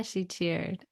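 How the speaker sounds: ordinary delivery, nothing marked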